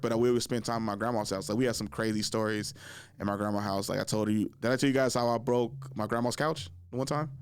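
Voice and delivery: ordinary delivery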